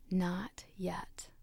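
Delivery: soft whispered refusal. Acted. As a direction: breathe it out calmly. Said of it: "simply saying".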